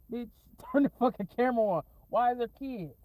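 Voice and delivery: loud, fast, laughing "turn the fucking camera on"; shocked shout. Real, not acted. Telling someone to film the kids at midnight, loud laughing shout. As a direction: yell it through a laugh, fast.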